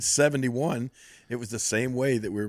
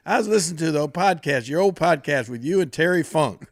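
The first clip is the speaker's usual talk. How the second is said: put-on louder, higher take on a Southern accent; imitated Southern accent; 5 semitones higher, 6 dB louder than usual. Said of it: "real Southern accent".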